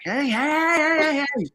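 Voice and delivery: high, drawn-out imitation of calling out; mock yelling. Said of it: "like, trying to yell to him".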